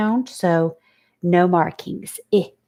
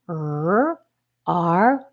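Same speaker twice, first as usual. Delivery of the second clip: ordinary talk, nothing marked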